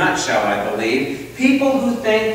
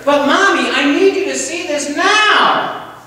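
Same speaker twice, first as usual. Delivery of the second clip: put-on higher, pleading child who won't give up; high, insistent little boy; 11 semitones higher, 4 dB louder than usual. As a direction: push harder like a stubborn kid. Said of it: "this little voice".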